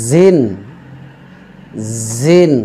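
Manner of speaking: জিন as "zin", with audible buzz. Shown as a demonstration. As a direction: let the z starting জিন buzz, drawn out. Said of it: "একটু আওয়াজ বের হবে".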